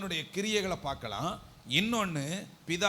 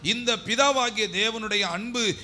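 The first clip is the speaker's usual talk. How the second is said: high, loud preacher's surge; impassioned preaching; 7 semitones higher, 7 dB louder than usual. Real, not acted. Preacher telling why Jesus could not sleep, impassioned preaching. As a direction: press on urgently in a raised voice.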